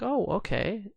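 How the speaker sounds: high, light voice for the wife; wife's quick okay. Retold or imitated. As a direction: play the wife, chirpy and breezy. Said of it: "she's like".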